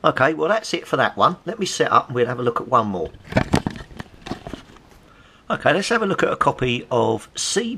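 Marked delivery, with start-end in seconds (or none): none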